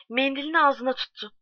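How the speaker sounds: plain reading voice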